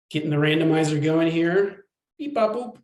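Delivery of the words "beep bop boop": robot beeps. Pretend. Imitating the randomizer running.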